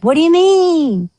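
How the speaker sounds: high, loud disbelief; can't believe it; shocked. Retold or imitated. Told they're overbooked, high shocked disbelief.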